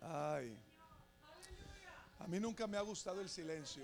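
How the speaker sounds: very soft, almost under the breath; barely audible confession; hushed aside. Real, not acted. Hushed confession after loud prayer.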